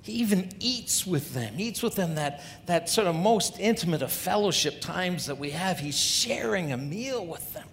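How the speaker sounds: a preacher mimicking sniffy Pharisees; Pharisees muttering. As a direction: play a disgusted religious snob gossiping. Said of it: "the Pharisees are muttering".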